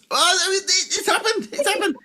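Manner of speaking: very high, thrilled squeal; mock excited. Host flicking channels and spotting the guest, mock excited squeal. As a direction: act amazed at spotting a friend on telly. Imagines seeing guest on the TV show.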